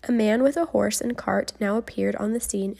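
plain reading voice

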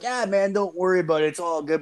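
lightly voiced easygoing kid; imitated reassurance. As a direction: voice the pal waving the worry away. Voices kid brushing off the worry.

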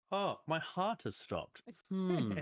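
high, slow, deadpan puzzlement; mock oblivious man. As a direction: act calmly unbothered by a stopped heart. Mocking the oblivious guy.

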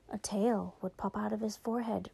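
whispered softly, like a secret; spooky whisper. Shown as a demonstration. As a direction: hush it to build suspense. Storyteller repeats line, then whispers.

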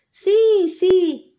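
very high, loud cheering yes; enthusiastic yes. A narrator's reading. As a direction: cheer it together. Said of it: "dicen todos muy entusiasmados". (sí muy entusiasmado, agudo y fuerte)

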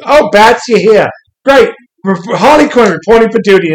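high, loud, bubbly Harley voice; chirpy Harley. Retold or imitated. Harley greets Batman after tickling Nightwing, high and bubbly. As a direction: bounce up and greet him brightly.

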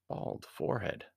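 usual speaking voice

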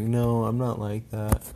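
slow mimicking girlfriend voice; slightly slow, mocking impression of her excuse; mock protest. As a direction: parody her defensive denial.

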